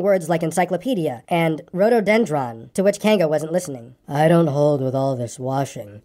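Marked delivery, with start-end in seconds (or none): 4.1-6.0 low, very slow gloomy donkey; slow, disapproving low grumble; grumbling. A narrator's reading. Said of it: "grumbled Eeyore".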